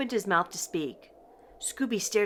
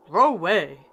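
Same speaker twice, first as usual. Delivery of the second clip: louder, gruff dog-speak refusal; Scooby dog voice; 2 semitones lower, 6 dB louder than usual. A narrator's reading. Scooby refusing to go inside, in a dog voice. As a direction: say no way like a cartoon dog.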